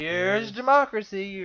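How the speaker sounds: loud, drawn-out movie-villain announcement; Jack Nicholson. Pretend. Joking that the voter burst through the curtain, Shining parody. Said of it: "it was Jack Nicholson, The Shining".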